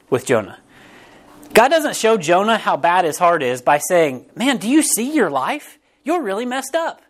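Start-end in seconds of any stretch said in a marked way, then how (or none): 4.4-7.0 higher-pitched mock counselor's scolding; blunt reproach. Preacher playing a counselor confronting Jonah, in blunt reproach. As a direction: lay it on as an incredulous rebuke.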